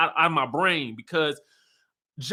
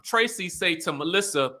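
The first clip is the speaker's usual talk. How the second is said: usual speaking voice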